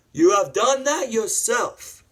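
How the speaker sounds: high, loud theatrical rebuke; hammed-up indignation; acted angry retort. Acts out Obi-Wan's retort.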